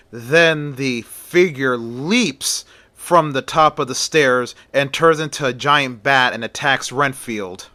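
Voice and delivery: plain reading voice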